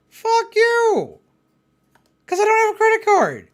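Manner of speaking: high screamed curse; hammed-up screaming caller; mock scream. Scam caller screams at him, mock scream. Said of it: "Screamed at me".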